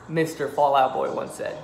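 usual speaking voice

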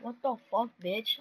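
ordinary delivery

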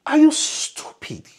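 high, loud, scornful divine voice; a mocking heavenly voice scolding the fool; voice from above. The angel's voice mocking the speaker, high and scornful. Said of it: "the voice said to me".

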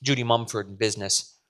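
usual speaking voice

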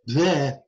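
"bleh" with a muddled consonant between b and d; in-between consonant attempt. Shown as a demonstration. Lecturer proving no sound lies between ba and da, failed consonant blend. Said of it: "can't make a sound between ba and".

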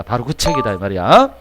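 normal talking voice

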